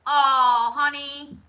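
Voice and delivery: ordinary delivery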